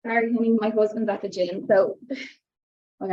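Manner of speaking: panting after running downstairs; breathless, gasping between phrases; out of breath. Real, not acted. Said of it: "I'm out of breath".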